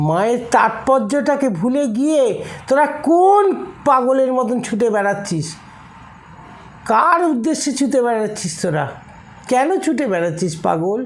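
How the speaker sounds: impassioned sermon rebuke; fervent scolding. Acted. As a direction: rebuke them with rising passion. Preacher scolding devotees.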